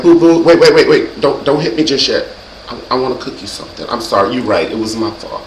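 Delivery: plead, then sink into meek apology; acted pleading. Placates angry man before he hits, acted meek pleading. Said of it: "You gotta do a little acting these days".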